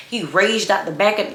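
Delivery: normal talking voice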